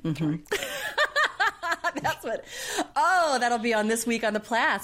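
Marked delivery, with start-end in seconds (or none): 0.4-2.0 high laugh. Real, not acted. Laughing at dad joke.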